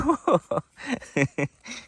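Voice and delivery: high falling giggle; real high giggle that falls away; amused laugh. Real, not acted. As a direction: laugh fondly at the foal. Amused at foal eating dirt.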